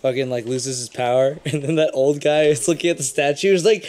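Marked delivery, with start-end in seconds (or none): none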